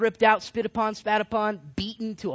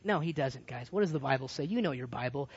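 normal talking voice

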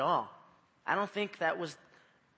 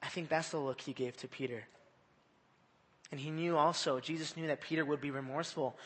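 ordinary talk

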